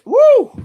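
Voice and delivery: loud, high whoop of joy; excited woo. Real, not acted. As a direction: whoop it out like a fan celebrating. Cheering a correct draft pick.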